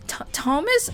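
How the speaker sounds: small high stammered squeak. Acted. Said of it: "she squeaks out".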